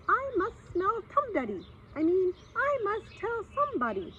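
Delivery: high squeaky little voice; scurry off squeaking; muttering mouse. Acted. Mouse hurrying off squeaking in a high voice. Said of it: "hurried away muttering to itself".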